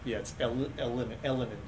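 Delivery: stumbling over a name; stuttering. Real, not acted. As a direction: trip over the name, restarting it quietly. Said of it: "I'm stuttering".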